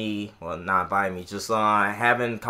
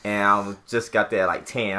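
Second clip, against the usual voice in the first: usual speaking voice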